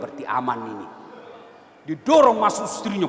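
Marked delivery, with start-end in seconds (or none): 0.0-0.9 much lower and softer, thinking to himself; quiet inner thought. Acted. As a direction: say it under your breath like a secret thought.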